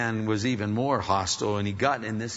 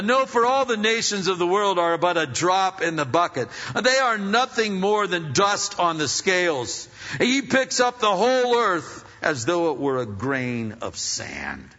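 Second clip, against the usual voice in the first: scripture reading; 5 semitones higher, 4 dB louder than usual. Real, not acted. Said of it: "here's out of the New Living Translation".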